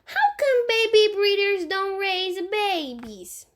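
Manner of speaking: put-on high little boy voice, slow; a small child explaining matter-of-factly. Fudge in the tree explaining to Sheila, in a high little boy voice.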